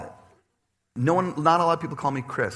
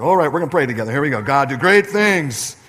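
mock prayer at work; 2 semitones higher, 9 dB louder than usual. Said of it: "you should do that at sappy".

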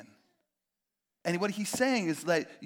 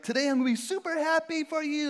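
mock chirpy hypocrite; 11 semitones higher, 6 dB louder than usual. Mocking a shallow hypocrite.

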